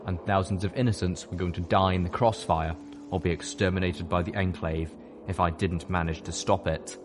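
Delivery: ordinary delivery, nothing marked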